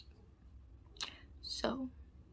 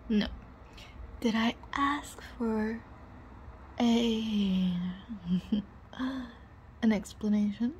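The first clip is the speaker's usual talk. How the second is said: ordinary talk, nothing marked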